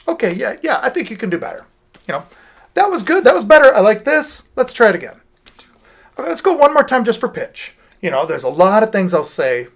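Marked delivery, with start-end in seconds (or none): 0.1-1.6 encouraging coach. Retold or imitated. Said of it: "when we're doing takes". 2.7-7.7 high, louder upbeat studio patter; encouraging coach. Retold or imitated. Teacher coaxing students through another take, high upbeat encouragement. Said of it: "when we're doing takes".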